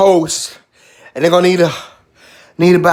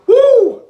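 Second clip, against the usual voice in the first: loud, high church-style whoop; 5 dB louder than usual. Real, not acted. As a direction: whoop out mid-prayer. Whooping during prayer over burn.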